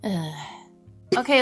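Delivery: ordinary talk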